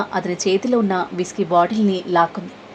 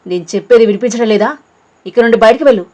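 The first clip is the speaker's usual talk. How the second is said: fast, loud furious yell; louder shout; 2 semitones higher, 7 dB louder, faster than usual. A narrator's reading. Deva yells at Preesha to get out, loud and fast. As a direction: shout her out of the room. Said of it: "మరింత గట్టిగా అరిచాడు".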